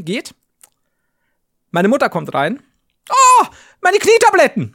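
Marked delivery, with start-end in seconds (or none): 3.1-4.7 high, loud, drawn-out cry of delight; mother's exclamation. Retold or imitated. Mother bursting into the room, high loud exclaim.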